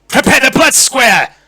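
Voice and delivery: loud, high command; full-voiced lordly yell. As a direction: shout an order across the hall. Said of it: "he yells".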